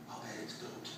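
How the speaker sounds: soft unvoiced whisper. Kids guessing his favourite food in bed, whispering. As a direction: hush it like kids in the dark. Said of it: "I hear them whispering inside".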